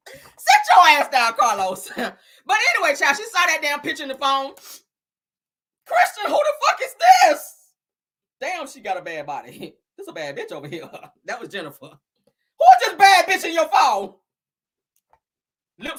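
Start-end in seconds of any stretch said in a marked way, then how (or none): 0.1-1.9 loud, slow telling-off; sassy loud scold. Real, not acted. Scolding Carlos King. 5.8-7.7 high, loud, slow furious demand; wife shouting at husband over phone photo; angry shout. Retold or imitated. 11.9-14.1 louder, higher, slow accusation; wife grilling husband over another woman; angry demand. Retold or imitated. Wife confronting husband over photo.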